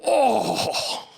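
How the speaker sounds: loud high excited Oh; nerd gasp. Retold or imitated. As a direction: gasp out like a thrilled moviegoer. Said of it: "somebody would go".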